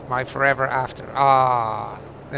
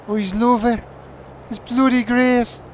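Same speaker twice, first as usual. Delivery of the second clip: higher, put-on gushing voice; mock doting voice; 9 semitones higher than usual. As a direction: gush in a silly voice about him. Voicing partner's praise mockingly.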